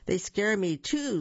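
ordinary delivery, nothing marked

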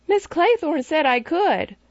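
put-on high, accusing child's voice; a boy telling adults the governess allowed it; tattling little boy. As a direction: tell on her like a small boy.

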